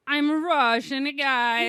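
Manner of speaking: deliberately bad Russian accent; joke Russian accent. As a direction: belt out a silly slow Russian accent. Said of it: "do a Russian accent".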